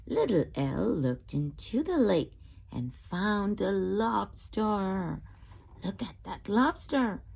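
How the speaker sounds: usual speaking voice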